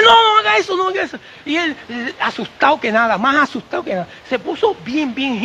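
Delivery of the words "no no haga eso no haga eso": alarmed shout. Retold or imitated. Doctor yells again in alarm.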